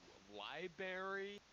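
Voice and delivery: high, slow, incredulous stammer; shocked disbelief. Retold or imitated. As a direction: gasp out library in disbelief. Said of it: "I'm like".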